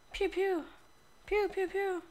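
high pew pew blaster noises; laser gun sounds. Pretend. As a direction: fire off mouth-made laser shots. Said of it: "I'm gonna make sound effects".

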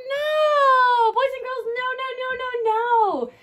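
high, loud mock-horrified no; mock horror. Teacher pretending shock at bad handwriting, high mock alarm. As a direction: pretend to be shocked by the messy writing.

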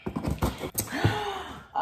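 high sharp gasp of delight. Real, not acted. Opening a mirror package, delighted gasp. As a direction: gasp in surprise.

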